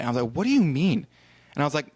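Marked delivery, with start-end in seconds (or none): 0.3-1.1 exasperated, higher-pitched disbelief; retold protest, pitch swooping up; incredulous question. Retelling argument with airline clerk.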